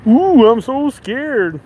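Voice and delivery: high, slow sarcastic taunt; imitating a drunk man's mocking reply. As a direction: stretch the ooh in mock fear. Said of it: "Then he's like".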